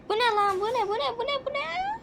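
panicked surprise that keeps rising in pitch; startled gasping. Real, not acted. Startled by something on screen. (nefesi kesilmiş, giderek tizleşen şaşkınlık)

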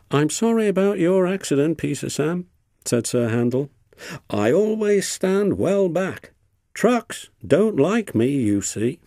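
high, falsely sorry engine voice; smug engine. A narrator's reading. Sir Handel fake-apologising to battered Peter Sam, smug and high. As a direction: sound sorry but smug.

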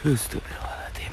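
hushed, voiceless whisper; whispered prayer. Real, not acted. Ghost hunter murmuring istighfar to himself, whispered. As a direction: whisper it under your breath.

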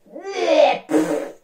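loud high bleh then a spitting pfft; disgusted raspberry. Acted. Froggy refusing chicken nuggets, disgusted raspberry. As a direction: blow a rude raspberry at the food.

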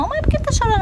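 put-on very high-pitched child's question; high little-girl voice, imitated; imitated little daughter. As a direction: voice a small girl asking her mother. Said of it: "a Sarinha olhava, olhava pra mim assim e falava".

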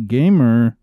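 loud, low, hammed-up taunting; loud mock taunt. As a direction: boom it out like a goofy bully. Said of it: "I don't know what came over me".

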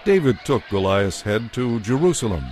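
usual speaking voice

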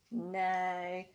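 ordinary delivery, nothing marked

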